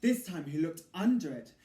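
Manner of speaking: ordinary delivery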